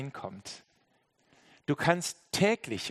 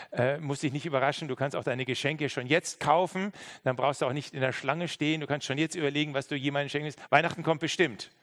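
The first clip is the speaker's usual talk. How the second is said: ordinary delivery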